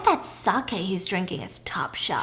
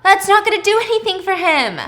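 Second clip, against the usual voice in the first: much higher and louder, disbelieving yell; exasperated yell; 9 semitones higher, 7 dB louder than usual. Retold or imitated. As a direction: yell at someone doing it wrong. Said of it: "They're like".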